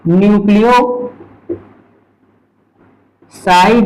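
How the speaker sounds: Nucleoside stretched very slowly with a rise; drawn-out term. Shown as a demonstration. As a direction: dwell on nucleoside for the class. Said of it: "तो हम देखें". (Nucleoside को खींचकर बोलना)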